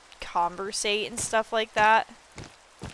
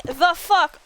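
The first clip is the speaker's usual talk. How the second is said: higher, louder stunned what; retold disbelief; 8 semitones higher, 7 dB louder than usual. Streamer retelling her reaction to the bison warning, stunned. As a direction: blurt it out, baffled. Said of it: "And I'm like".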